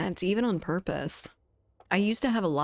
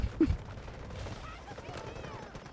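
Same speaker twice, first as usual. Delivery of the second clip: a long squealed laugh, soft and high; high squealing laugh; 9 dB quieter than usual. Real, not acted. Laughing at being called the wrong name, long high squeal.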